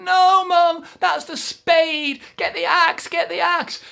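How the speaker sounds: high, loud desperate yell; high urgent shout; frantic shout. Acted. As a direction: shout down at Mum. Jack frantic again.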